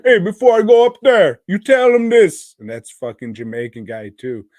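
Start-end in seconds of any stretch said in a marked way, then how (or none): 0.0-2.5 put-on loud, high, fast African-accent shout; mock African accent. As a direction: holler it in a caricatured African accent. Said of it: "talking like a fucking African".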